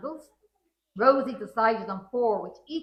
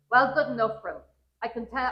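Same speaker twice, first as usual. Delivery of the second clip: ordinary delivery, nothing marked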